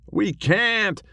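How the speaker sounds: high moaning cowboy ghost. A narrator's reading. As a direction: moan it up high and slow. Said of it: "moaned Billy".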